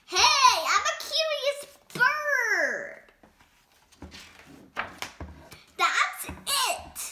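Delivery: lively picture-book read-aloud; animated storytime reading; reading to children. A narrator's reading. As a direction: read it to a room of kids.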